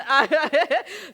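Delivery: a real laugh, loud and bright; loud amused laugh; burst of laughter. Real, not acted. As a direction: laugh out loud at your own joke. Laughing at her own joke.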